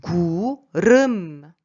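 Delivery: 구름 slow and loud with a trilled R in 름; emphatic slow model of 구름; rolled R. Shown as a demonstration. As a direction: stretch 구름 and roll the second syllable. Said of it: "Vous avez entendu?".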